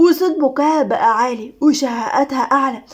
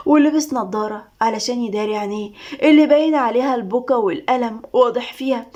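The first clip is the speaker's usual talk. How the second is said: ordinary delivery, nothing marked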